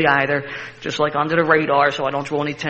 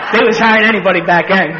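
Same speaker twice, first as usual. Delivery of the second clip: laughing; 4 semitones higher, 8 dB louder than usual. Real, not acted. Laughing remark.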